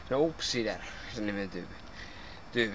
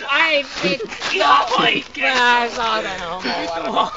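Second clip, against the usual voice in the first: angry shout; 6 semitones higher, 5 dB louder than usual. Real, not acted. Gaming frustration outburst.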